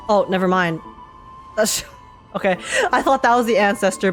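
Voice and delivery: ordinary talk, nothing marked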